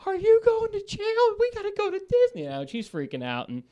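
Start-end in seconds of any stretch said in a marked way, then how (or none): 0.0-2.6 put-on kid's voice. Dad imitates his kid asking if he's going to jail. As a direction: mimic the child asking about jail. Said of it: "my kid's like".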